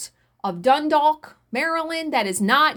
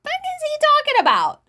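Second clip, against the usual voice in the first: much higher, incredulous What the fuck; aghast question to her mother; appalled disbelief. Retold or imitated. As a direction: ask it in open disbelief at the guy. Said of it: "looked at my mother like".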